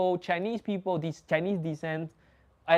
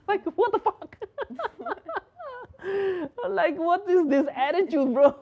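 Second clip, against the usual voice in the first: much higher, laughing through the words; laughing disbelief. Real, not acted. Telling the friend about the rude kid, laughing hard. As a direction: crack up in disbelief.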